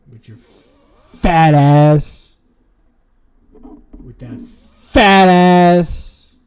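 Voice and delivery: drawn-out loud shout; slow mocking yell. Real, not acted. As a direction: yell it slowly at her. Yelling tease at Zoe.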